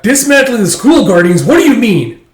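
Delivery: high, loud, indignant outburst; loud, incredulous challenge; outraged protest. Acted. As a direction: act out shocked objection at high pitch. Acting out protesting apprentices.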